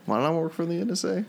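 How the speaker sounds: high, put-on movie character voice; Will Hunting voice. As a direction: recite the Good Will Hunting monologue in character. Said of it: "Good Will Hunting".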